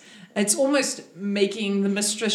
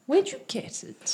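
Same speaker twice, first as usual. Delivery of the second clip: much higher, softer syrupy question; sweet mistress; 9 semitones higher, 3 dB quieter than usual. Acted. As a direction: ask sweetly with a hidden edge. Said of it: "mistress asks about fabric".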